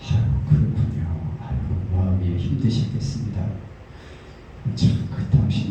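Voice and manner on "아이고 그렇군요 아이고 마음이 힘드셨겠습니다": low, unhurried consoling reply; gently commiserate in a lowered voice; soothing sympathy. Retold or imitated. Pastor hearing out an upset deacon, soothing.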